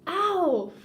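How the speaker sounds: loud, high sudden yelp of pain; mock yelp of pain. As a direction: yelp as the foot breaks. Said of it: "That's me, by the way".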